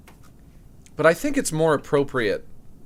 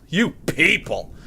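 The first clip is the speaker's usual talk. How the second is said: drawn-out, emphatic mock accusation; mock indignation; 4 semitones higher, 3 dB louder, slower than usual. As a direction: stretch it out, scolding the chat in jest. Mock address to chat, repeated.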